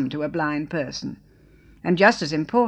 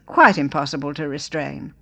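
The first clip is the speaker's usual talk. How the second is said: usual speaking voice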